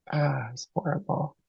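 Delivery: ordinary delivery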